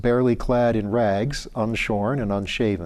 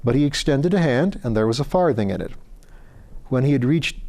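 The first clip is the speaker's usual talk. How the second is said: plain reading voice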